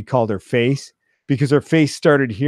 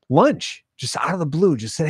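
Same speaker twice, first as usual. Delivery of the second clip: ordinary delivery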